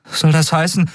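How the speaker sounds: high, trembling with excitement; hardly believe it; excited boy. Acted. (aufgeregte Jungenstimme)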